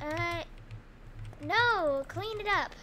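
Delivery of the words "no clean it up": scared horror game streamer. Real, not acted. Panicked reaction in game.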